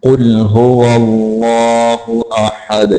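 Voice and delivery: हुवल्लाहु with thick, dark double l; slow, rounded हुवल्लाहु; heavy Allah. Shown as a demonstration. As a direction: recite हुवल्लाहु slowly, full-mouthed "llaah". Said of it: "लफ्ज़ अल्लाह को मोटा करके पढ़ेंगे".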